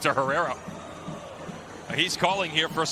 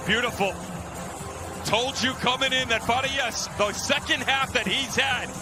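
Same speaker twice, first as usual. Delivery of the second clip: excited; 6 semitones higher than usual. Real, not acted. Color analyst excited after goal.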